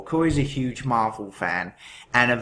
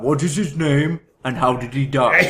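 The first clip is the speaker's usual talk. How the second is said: poor mock-Austrian impression, louder than usual; Arnold Schwarzenegger; 3 semitones higher, 5 dB louder than usual. Podcaster recalling an old guessing game, bad Arnold impression. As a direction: do a bad action-star accent, punched out. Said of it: "that's my poor Arnold Schwarzenegger impression".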